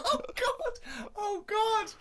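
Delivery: high shriek of laughter. Real, not acted. Cohost shrieking with laughter at Steve's humiliation. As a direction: howl at the punchline.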